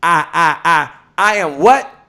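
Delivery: rhythmic, punchy call for a response; remix version. Shown as a demonstration. Host cueing the audience to answer, rhythmic chant. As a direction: chant the stuttered "I", then toss out "what". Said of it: "give you the [name] remix version".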